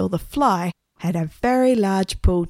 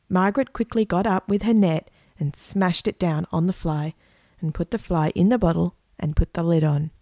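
usual speaking voice

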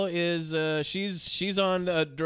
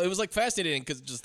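ordinary talk

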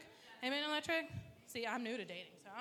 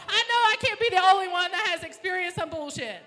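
exasperated; 5 semitones higher, 9 dB louder than usual. Acted. Exasperated inner voice.

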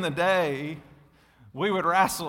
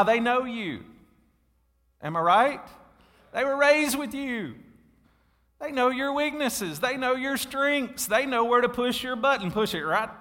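high, loud preaching build; preaching at full force; 8 semitones higher, 3 dB louder than usual. Real, not acted. Pastor preaching hard to the congregation, loud and high. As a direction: drive it home to the church, full voice.

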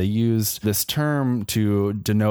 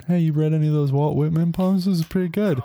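pitched-up mock cowboy feeling someone out; cowboy dropping a hint; 6 semitones higher than usual. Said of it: "you just bring up Walt Whitman".

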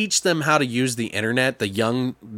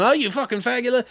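mock yell; 9 semitones higher, faster than usual. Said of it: "yell at him".

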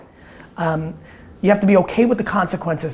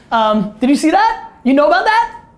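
excited; 8 semitones higher, 5 dB louder than usual. Real, not acted. Excited about the dripping pipe.

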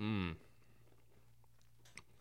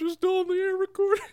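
laughing; 3 dB louder than usual. Real, not acted. Laughs at pre-recorded report.

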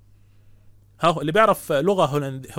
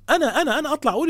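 incredulous; 7 semitones higher, faster than usual. Real, not acted. Incredulous rhetorical self-example.

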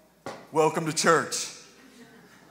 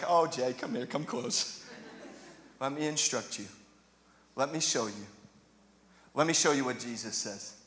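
gentle God voice; 6 semitones lower, 7 dB quieter than usual. Retold or imitated. God gently answering the whiner.

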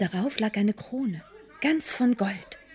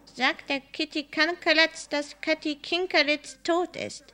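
higher, slow, strange cat voice; a cat rising on its hind legs speaks to the mother, eerily; catty voice; 6 semitones higher, slower than usual. A narrator's reading. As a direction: speak it slowly like a talking cat. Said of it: "mit einer katzigen Stimme gesagt".

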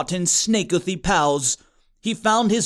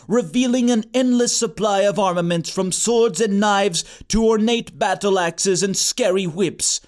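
plain reading voice

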